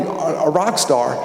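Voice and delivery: ordinary talk, nothing marked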